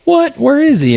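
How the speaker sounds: a sudden high yelp of disbelief; startled surprise. Retold or imitated. Customer spinning round to find Adam, startled high cry. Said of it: "he shot around, turned around".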